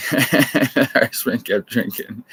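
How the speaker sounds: slow, laughing through the words; can't stop laughing at his own pub joke. Real, not acted. Telling an Irishman pub joke, laughing through it.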